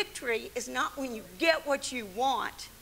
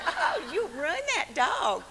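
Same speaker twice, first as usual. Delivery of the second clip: mock scolding groomer; 5 semitones higher, 4 dB louder, slower than usual. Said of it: "She said".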